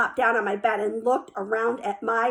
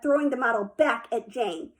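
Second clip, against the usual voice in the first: usual speaking voice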